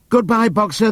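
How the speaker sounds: high, cheerful farewell; chorused goodbye. Acted. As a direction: sing out a goodbye together. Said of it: "they chorused".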